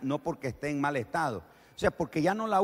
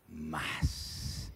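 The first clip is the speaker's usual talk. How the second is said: whispered word; 11 semitones lower, 10 dB quieter than usual. Real, not acted. Urging to shout more.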